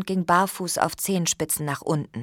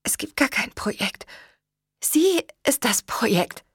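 strained, raised-pitch reveal; emphatic admission; 8 semitones higher than usual. A narrator's reading. Simon reveals the project was her.

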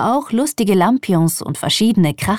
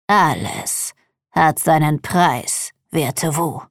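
sly villain; much slower than usual. A narrator's reading. The customer coolly dismisses Madame Wu, slow and sly. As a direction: savour each word like a villain.

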